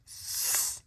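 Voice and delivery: hissing snake; long snake hiss. Acted. Animals chase dog.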